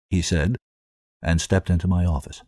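plain reading voice

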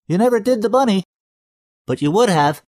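high, loud cartoonish teasing; old friend teasing through the door; comedy voice. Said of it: "the cartoon character he sounded like".

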